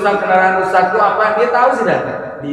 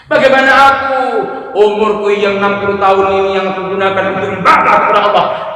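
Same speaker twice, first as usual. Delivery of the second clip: higher, louder despairing cry; anguished lament; 4 semitones higher, 6 dB louder than usual. Acted. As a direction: plead with yourself, what about me. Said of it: "menangis teriak".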